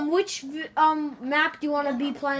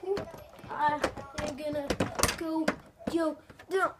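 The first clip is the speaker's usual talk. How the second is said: normal talking voice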